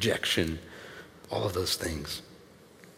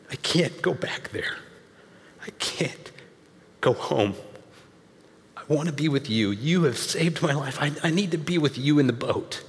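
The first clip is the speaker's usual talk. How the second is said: a hint of a desperate plea; begging; 3 semitones higher than usual. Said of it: "He's begging Jesus".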